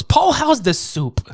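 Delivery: put-on high, slow old lady. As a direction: ask sweetly like an old woman. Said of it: "funny conversation".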